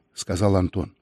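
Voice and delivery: plain reading voice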